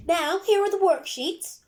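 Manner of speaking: high, loud, drawn-out announcing; teacher's announcement. A narrator's reading. Teacher handing out worksheets to the class, announcing loudly. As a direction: announce it slowly to the whole class. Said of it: "announced Miss Verity".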